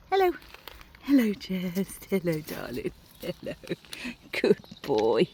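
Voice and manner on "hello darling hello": ordinary talk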